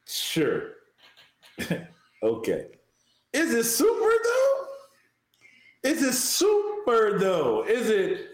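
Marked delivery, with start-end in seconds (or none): 3.3-4.6 teasing question. Pretend. 5.9-8.3 emphatic call-out. Pretend. Repeating it emphatically.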